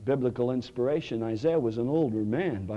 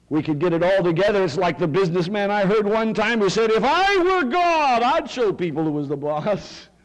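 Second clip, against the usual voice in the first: higher, louder, driving sermon voice; full-force preaching; 8 semitones higher, 8 dB louder than usual. Acted. Pastor preaching to the congregation, full force.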